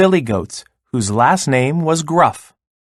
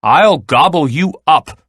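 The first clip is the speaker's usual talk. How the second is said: louder, higher storybook threat; troll shout; 4 semitones higher, 5 dB louder than usual. A narrator's reading. Troll threatening the little goat, storybook shout. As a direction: call it out as a menacing troll. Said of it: "shouted the troll".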